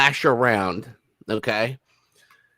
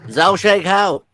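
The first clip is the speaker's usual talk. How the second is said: mock Mandarin greeting; 8 semitones higher, 5 dB louder than usual. Mocking the prince's Mandarin greeting.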